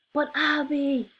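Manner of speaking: high, slow scream. A narrator's reading. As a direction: scream in panic. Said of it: "Mary screamed".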